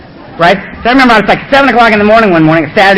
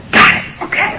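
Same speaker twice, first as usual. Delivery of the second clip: whisper; 4 dB quieter than usual. Retold or imitated. Sheepish reply from back.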